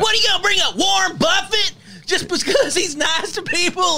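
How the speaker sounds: high, loud laughing shout; mocking yell. Cohost shouting down a Buffett fan, laughing yell. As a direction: yell it, cracking up.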